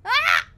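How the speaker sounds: short, high, loud shriek; startled scream. Real, not acted. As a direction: scream out in fright. Attacked in game.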